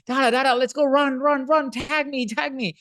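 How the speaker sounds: put-on high, excited little kid begging to play; high child's voice pleading to run and play tag; toddler voice. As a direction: pipe up like an eager small boy. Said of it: "So he's going".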